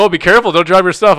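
loud, high retold blurt; mock flustered warning. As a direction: call it out, imitating a flustered fan. Retold warning while dropping everything.

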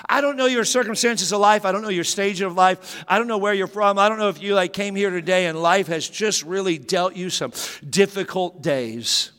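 ordinary talk, nothing marked

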